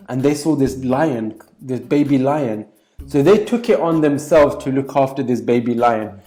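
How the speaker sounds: ordinary delivery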